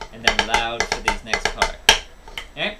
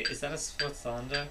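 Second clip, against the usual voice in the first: mock cool student; 8 dB quieter than usual. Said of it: "if you wanna sound cool in your music class one day, say".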